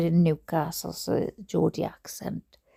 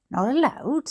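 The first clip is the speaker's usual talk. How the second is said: high-pitched indignant question; 7 semitones higher, 4 dB louder than usual. A narrator's reading. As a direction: echo it back in a high, swooping voice. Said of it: "said my mother in a high tone".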